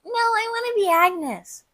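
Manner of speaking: put-on high-pitched, drawn-out kid protest; mock stubborn child in a high voice; childlike insistence. Retelling turning down friends' group costume, childlike insistence.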